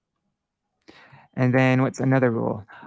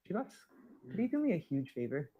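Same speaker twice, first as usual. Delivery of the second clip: quieter aside, off mic; aside to someone nearby; 3 semitones higher, 7 dB quieter, faster than usual. Real, not acted. Teacher asking a helper to call someone, quiet off-mic aside. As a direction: turn away and ask a favor quietly.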